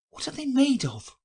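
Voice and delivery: high, eager question; great curiosity. A narrator's reading. Alice asks the Gryphon what soles are made of, eagerly curious. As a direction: lean in, dying to know. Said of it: "in a tone of great curiosity".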